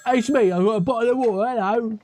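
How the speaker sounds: high, hammed-up mock British accent; terrible British accent. Player mocking the English caller in a silly British accent. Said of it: "terrible British accent".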